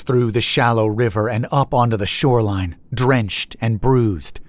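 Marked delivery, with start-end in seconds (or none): none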